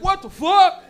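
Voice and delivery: high, loud mock disbelief; astonished yell. Viewer seeing the finished giant artwork, astonished loud yell. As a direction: burst out in hammed-up amazement.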